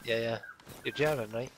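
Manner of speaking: strong Scottish accent. Real, not acted. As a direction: talk in a broad Scottish accent. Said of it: "you can hear the Scottish very well".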